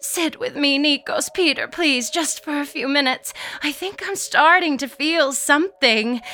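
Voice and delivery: high, pleading little voice; feeble plea. A narrator's reading. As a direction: plead gently, pitched well up. Lydia pleads, feigning pain easing.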